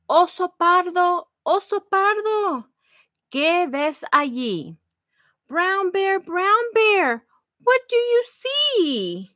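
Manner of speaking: bright, lilting read-aloud for little ones; sing-song the refrain to the kids; reading to children. Acted. Librarian reading the refrain to children, sing-song.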